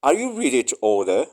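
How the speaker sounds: ordinary talk, nothing marked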